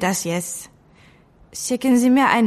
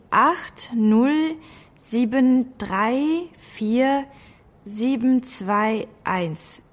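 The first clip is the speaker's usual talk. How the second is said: slow dictation; much slower than usual. Acted. Reads invoice number digit by digit.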